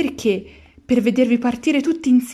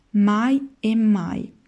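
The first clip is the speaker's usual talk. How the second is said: slow emphatic; much slower than usual. Acted. Lucignolo insists again.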